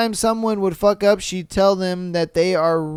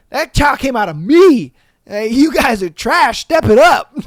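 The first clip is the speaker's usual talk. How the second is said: louder, higher gloating; boastful mom; 6 semitones higher, 6 dB louder than usual. Retold or imitated. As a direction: crow like a smug proud mother. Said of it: "It's like".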